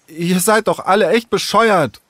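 high-pitched, furious insult; angry. Acted. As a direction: lash out at them in a huff. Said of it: "wütend warf Sarah die Haare". (wütend geschimpft)

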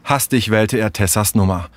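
plain reading voice

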